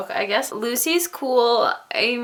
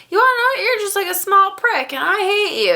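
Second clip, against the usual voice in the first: higher, louder mock telling-off; mock angry outburst; 11 semitones higher, 4 dB louder, faster than usual. Lucy telling off Josh's father, mock angry shout. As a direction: hams up a girl yelling at a bully dad. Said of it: "Lucy stands up to him".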